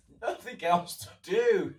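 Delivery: high, loud cry of exasperation breaking into laughter; going crazy and laughing about it. Real, not acted. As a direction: drag it out high, then crack up. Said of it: "I'm laughing here".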